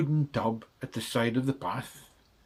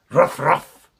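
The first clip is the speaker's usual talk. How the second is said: loud ruff barks; dog ruff; 3 semitones higher, 11 dB louder than usual. Acted. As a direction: bark ruff ruff. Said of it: "said Muffin McClay".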